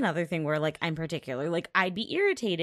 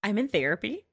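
ordinary talk, nothing marked

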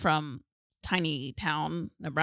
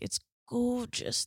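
put-on British accent; 6 semitones higher, slower than usual. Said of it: "They're British".

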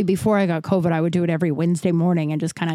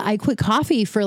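usual speaking voice

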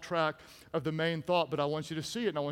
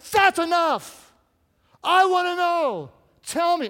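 exasperated demand; 10 semitones higher, 8 dB louder than usual. Acted. Preacher as Delilah fed up with Samson, exasperated yelling. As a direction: lay down the law, fed up.

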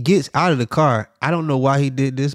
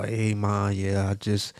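low, soft, shamefaced admission; small subdued voice, acting out the shame; sheepish confession; 4 semitones lower, 7 dB quieter than usual. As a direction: own up to Mom reluctantly. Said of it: "that was that walk of shame call".

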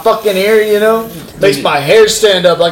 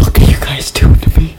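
laughing whisper; faster than usual. Real, not acted. Laughing whisper in ASMR bit.

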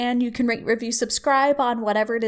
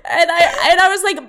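high, loud laughing that verges on tears; cry laughing; 8 semitones higher, 6 dB louder, faster than usual. Real, not acted. Telling a work story, cry laughing. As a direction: laugh till you cry while telling it. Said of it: "I seriously was cry laughing like I am right now".

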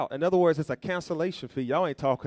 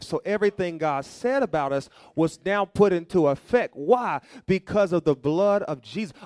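ordinary talk

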